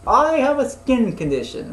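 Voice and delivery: high, loud cartoon retort; indignant cartoon alien. Alien Zim excusing his green skin, high indignant shout. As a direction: protest loudly in a high put-on voice. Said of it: "He's like".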